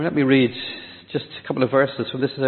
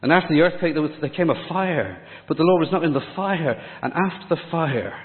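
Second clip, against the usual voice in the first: ordinary talk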